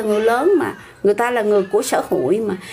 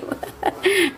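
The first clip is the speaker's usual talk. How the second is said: short laugh; 9 semitones higher, 4 dB quieter than usual. Real, not acted. Amused by the comment.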